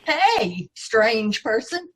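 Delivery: usual speaking voice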